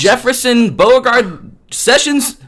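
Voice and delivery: high, incredulous sarcasm; sarcastic. Real, not acted. Mocking claim Sessions is Democrat.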